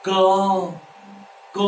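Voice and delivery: isolated, drawn-out "क"; throat-made क. Shown as a demonstration. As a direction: release "क" from the throat with a clear burst of air. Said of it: "बोल के बताओ जरा".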